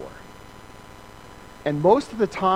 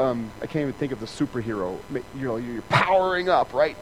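ordinary talk

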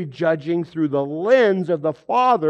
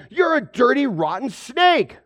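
mock scolding; 8 semitones higher, 3 dB louder than usual. Scolding a snake in the garage.

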